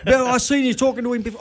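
quick, high-pitched mock accusation; cranky publican. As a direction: imitate an irate landlord catching them out, fast and high. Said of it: "he was cranky as".

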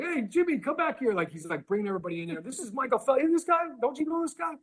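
a fan who won't drop it; insistent customer voice. Retold or imitated. Customer insisting to Eli he's Phelps, high eager voice. Customer calling coworkers over.

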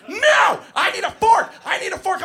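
put-on high, loud bratty toddler demand; screaming preschooler. Teacher mimics the boy yelling for a fork, high and loud. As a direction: yell it like a kid on the edge of a tantrum.

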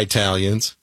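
"Italians" starting like the word eye; colloquial mispronunciation of Italians. Shown as a demonstration. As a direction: say "Italians" as eye-TAL-yuns. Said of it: "some people say Italians sometimes".

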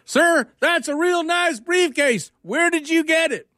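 very high, very slow Georgia drawl, mocking; exaggerated Georgia accent. Georgia guy repeating himself, high slow Georgia drawl. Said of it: "he shrugs and says".

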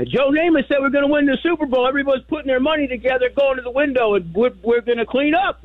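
dad hyped about betting on the Jets; excited dad. Retold or imitated. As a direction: play the giddy father who's sure he'll win. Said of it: "He goes".

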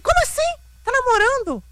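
high-pitched, indignant retold outburst; offended disbelief. Daughter hears the widow is dating, offended disbelief. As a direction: act out being scandalized, voice leaping way up. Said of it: "eu tava ofendidíssima".

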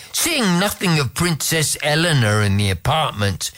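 ordinary delivery, nothing marked

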